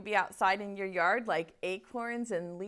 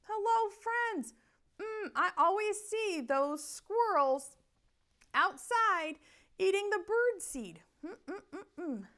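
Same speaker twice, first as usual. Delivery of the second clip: high, playful puppet voice; puppet bird voice; 9 semitones higher than usual. Acted. Puppet Betsy voice.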